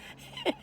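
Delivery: high squealing laugh; helpless high-pitched giggle; burst of laughter. Real, not acted. Laughing at her dog's funny face.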